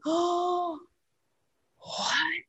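hushed, high-pitched imitated gasp and disbelief; soft, high mock astonishment; mock shocked gasp. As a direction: imitate a whole room gasping. Room's shocked reaction acted out.

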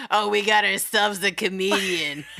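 lightly voiced gruff waitress; a hint of a sarcastic waitress; gravelly voice.

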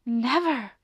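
mild frightened gasp before speaking. A narrator's reading. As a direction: catch your breath in fright. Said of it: "gasped Annie, shaking with fright".

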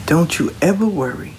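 ordinary talk, nothing marked

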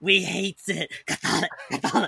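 hissing creature rasp; a light Gollum impression. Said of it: "It's Gollum".

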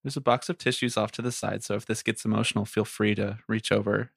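soft, gentle counselor tone; calm therapist offering tissues. Pretend. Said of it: "therapist voice the entire time".

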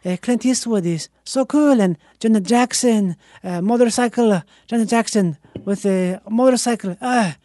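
mock an admiring Italian professor. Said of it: "that's just my old Italian professor".

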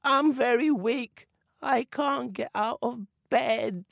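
high, slow, feeble sickbed voice; weak sick voice. Acted. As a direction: fake being too ill to rise. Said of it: "came a weak voice".

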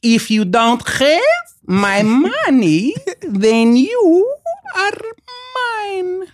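high, slow, drawn-out mock Russian menace; imitating Malkovich's Russian accent. Actor retelling his co-star's first take, high slow Russian accent. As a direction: stretch every word high and slow like a hammy villain. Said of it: "he goes".

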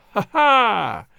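big hearty belly laugh; uproarious laugh. Acted. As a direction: burst out laughing loudly. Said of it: "He burst into an uproarious fit of laughter".

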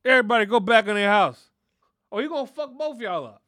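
loud, threatening shout; grandpa yelling. Retold or imitated. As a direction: order everybody inside at full volume. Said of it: "He'd be like".

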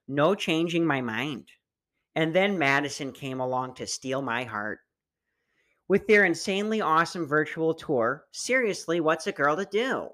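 lightly voiced Midwestern lady; a hint of a chirpy Wisconsin gal; Wisconsin accent. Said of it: "read this in a fat Wisconsin voice".

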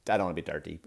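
usual speaking voice

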